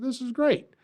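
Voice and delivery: thrilled at finding the perfect stage; excited. Retold or imitated. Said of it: "I said".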